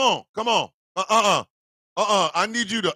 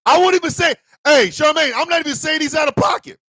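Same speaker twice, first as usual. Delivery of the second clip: excited laughing shout; 7 semitones higher, 3 dB louder than usual. Real, not acted. Laughing outburst at Charmaine.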